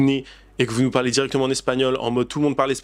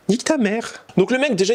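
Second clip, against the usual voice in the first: sudden indignant outburst; 10 semitones higher than usual. Real, not acted. Outburst at entitled tourists.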